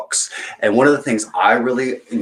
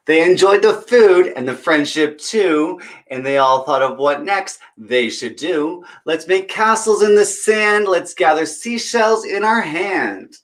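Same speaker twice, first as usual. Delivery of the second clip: put-on bright, higher read-aloud voice for kids; storybook reading; 8 semitones higher, 4 dB louder than usual. Storyteller reading a picture book to children, lively. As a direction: read it like story time at the library.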